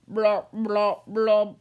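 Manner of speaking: usual speaking voice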